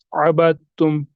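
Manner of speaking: "अबदतुम" read as "abat-tum"; careful teacher's model of "अबदतुम", dal silent; merged pronunciation. Shown as a demonstration. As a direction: drop the द in "अबदतुम" and double the t. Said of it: "हम इस तरह पढ़ेंगे".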